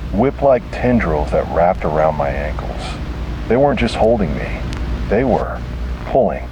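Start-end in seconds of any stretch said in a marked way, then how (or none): none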